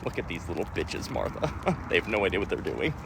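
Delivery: a hint of a scornful grandpa talking to Martha; mocking old boomer. Boomers watching from above mocking parents, low and soft. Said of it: "boomers ... being like".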